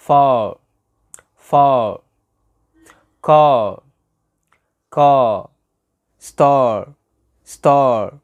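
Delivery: "far", "car", "star" ending on a loud held "aah"; long final AH. Shown as a demonstration. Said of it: "الصوت ده في آخر الكلمة".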